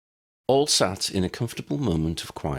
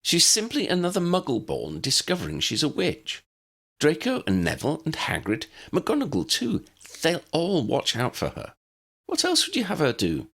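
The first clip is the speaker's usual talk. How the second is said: put-on much higher, firm young woman; Hermione, insistent; 12 semitones higher than usual. A narrator's reading. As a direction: argue her case with conviction. Hermione voiced by narrator.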